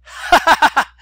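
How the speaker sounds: loud, high "ha, ha" laugh; loud laughter. Acted. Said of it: "said the gentleman".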